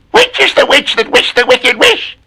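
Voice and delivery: high cackling witch tone. Acted. Witch asking about the wish, high screechy.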